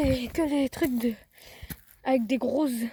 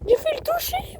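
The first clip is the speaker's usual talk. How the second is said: high startled cry; surprised. Real, not acted. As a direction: yelp it in shock. Nearly hit a fish.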